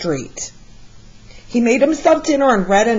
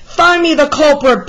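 loud, higher gruff command; barked order; 6 semitones higher, 3 dB louder than usual. Acted. Police chief ordering his officers, barking loudly. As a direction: bark it at the officers. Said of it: "barked the chief of police".

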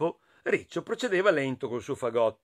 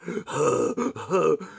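heavy breath. A narrator's reading. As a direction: breathe noisily like a big creature close by. Imitates the nearby breathing. (respiro rumoroso imitato)

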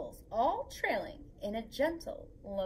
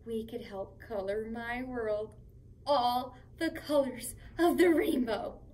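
plain reading voice

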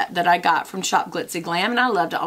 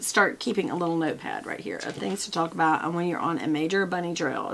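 ordinary talk

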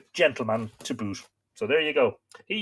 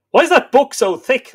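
high, loud curious asker; asking voice; 12 semitones higher, 11 dB louder than usual. Retold or imitated. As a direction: pitch the question way up, loud. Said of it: "And they said".

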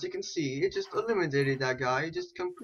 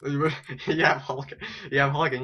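ordinary delivery

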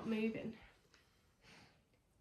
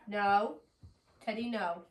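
ordinary talk, nothing marked

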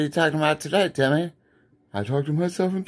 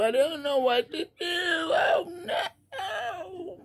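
much higher, rising into a shrieking oh no; mock panic; 14 semitones higher, 5 dB quieter, slower than usual. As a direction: spiral into hammed-up panic.

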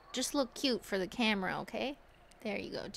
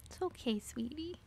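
soothing pet talk; 5 semitones higher, 6 dB quieter than usual. Real, not acted. Soothing cat.

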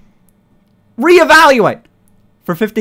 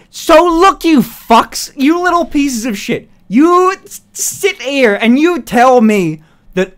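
angry rant; 5 semitones higher, slower than usual. Real, not acted. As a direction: tear into the chat. Partner blocks ears, rant resumes.

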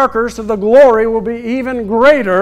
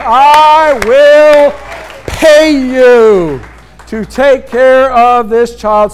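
I will pay you boomed out, louder and slower; loud emphatic proclamation; 5 dB louder, slower than usual. Acted. Preacher booms the princess's promise to the congregation, loud and emphatic. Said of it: "and I will pay you".

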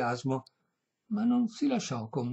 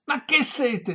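high, loud protest; exclamation; 11 semitones higher, 6 dB louder, slower than usual. A narrator's reading. Violinist refusing a drink, loud high exclamation. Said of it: "esclamò questi".

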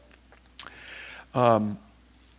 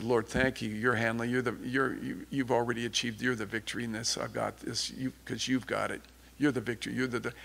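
softer, murmured retold prayer; hushed prayer; 2 semitones lower, 9 dB quieter than usual. Retold quiet prayer to God.

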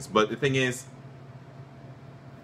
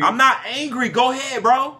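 high, loud, exasperated protest; angry denial; 9 semitones higher, 5 dB louder than usual. Real, not acted. Co-host denies being angry after teasing, heated. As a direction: insist hotly, voice pushed up.

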